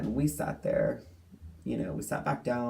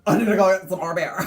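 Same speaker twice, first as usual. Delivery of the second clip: put-on fast, much louder, higher drunken declaration; imitating the drunk woman hollering; loud drunk announcement; 7 semitones higher, 11 dB louder, faster than usual. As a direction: blurt it like a tipsy fan. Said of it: "and she's like".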